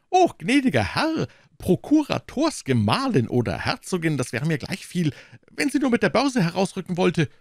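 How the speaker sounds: high, fretful innkeeper voice, wringing his hands; anxious innkeeper. Acted. Innkeeper pleading with D'Artagnan, high and anxious. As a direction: play the worried host complaining.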